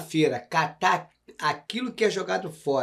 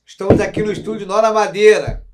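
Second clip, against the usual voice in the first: ad read; 5 semitones higher, 12 dB louder than usual. Acted. Sponsor shout-out read.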